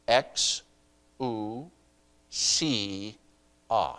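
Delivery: X, U, C, A spelled out slowly, one syllable at a time with pauses. Shown as a demonstration. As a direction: break the word into X, U, C, A. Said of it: "now I'm gonna say it in the syllables".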